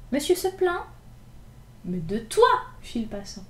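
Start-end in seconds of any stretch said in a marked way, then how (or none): 0.1-0.8 much higher, mocking innocence; cheeky. Gavroche mocking the splashed passer-by, cheeky. 1.8-2.5 lower, louder gruff snap; angry retort. Acted. Passer-by answering Gavroche angrily.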